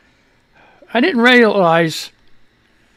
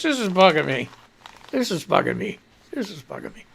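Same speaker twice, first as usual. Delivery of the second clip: fading mutter; 8 dB quieter than usual. Real, not acted. Repeated while fixing hair.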